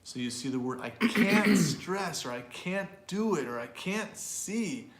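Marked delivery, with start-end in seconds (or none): 0.8-2.2 "can't" high, loud and slow; stress on can't. Shown as a demonstration. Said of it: "we often stress negative". 2.3-3.5 "can't" pitched up and leaned on; stress on can't. Shown as a demonstration. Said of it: "we often stress negative". 3.6-4.9 slow, higher "can't"; stress on can't. Shown as a demonstration. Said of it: "we often stress negative".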